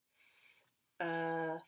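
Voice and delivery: "s", then a long "ur" ending in "f"; sounded out. Shown as a demonstration. Teacher helps children spell, "s, earth" sounded out as s-ur-f. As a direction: stretch "s, earth" as s, ur, f. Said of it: "sentence I would like you to write".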